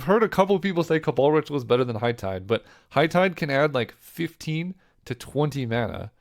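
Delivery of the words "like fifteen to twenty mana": ordinary talk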